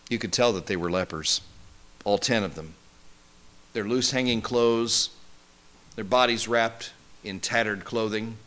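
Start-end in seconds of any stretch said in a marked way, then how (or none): none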